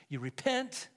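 ordinary talk

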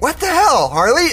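ordinary delivery

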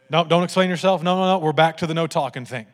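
forceful parental interruption; loud cutting-off. Retold or imitated. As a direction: shut the kids down loudly. Acted dad cutting off the boys.

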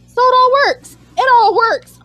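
high, loud and emphatic; excited approval. Real, not acted. Fan approving the Abigail rumour, excited. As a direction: declare it triumphantly, pitch up.

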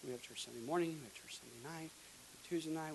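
normal talking voice

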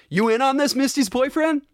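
high-pitched, rising challenge; imitated movie question. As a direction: quote it high, lifting into a question. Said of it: "he's like".

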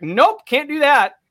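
a hint of a stubborn Montana farmer refusing flatly; gruff farmer. Farmer refuses to help the bloody stranger, lightly voiced gruff refusal. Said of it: "like, rugged ass fucking Montanan farmer".

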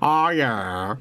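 low, comic Scottish voice; mock Scottish accent. Host clowning over the singer's accent, mock Scottish. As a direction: drop low into a rough Scottish impression. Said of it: "Oh, Scottish".

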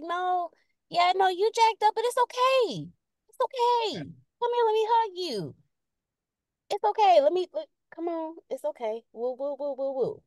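deutlich höher, tröstend und süßlich; sugary consoling. Retold or imitated. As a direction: wie jemand, der dich tröstend umarmen will. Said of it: "I'm the sugary person. I'ma hug on you".

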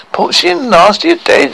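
high, shocked question; appalled. Acted. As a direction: ask in horrified disbelief.

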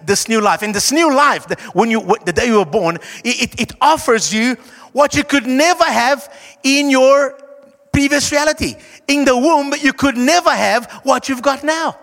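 normal talking voice

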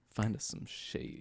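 slow soft whisper. Pretend. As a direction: breathe it out slowly. Joke line, unvoiced.

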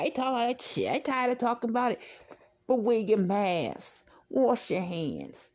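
normal talking voice

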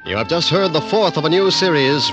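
usual speaking voice

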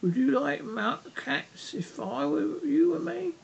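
high-pitched indignant mouse, drawn out; shrill, passionate. A narrator's reading. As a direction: squeak it out as an impassioned mouse. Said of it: "in a shrill, passionate voice".

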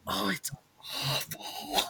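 slow, breathy whispered verdict; whispered aside. Retold or imitated. Customer whispering to her friend about the bad drink, slow whisper. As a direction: whisper it slowly behind a hand. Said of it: "And she goes".

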